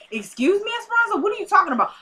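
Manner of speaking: high, rapid mock outrage; indignant protest. Claudia protesting to Esperanza in retold quarrel, indignant. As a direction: cut in with a high-pitched protest.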